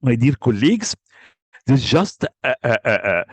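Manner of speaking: ordinary talk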